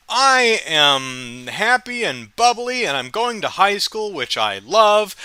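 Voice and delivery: put-on high, slow, sing-song bubbly teen voice; bubbly schoolgirl. Girl heading to school, voiced high and bubbly. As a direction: gush like a carefree girl off to school. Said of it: "bubbly, happy high school kid".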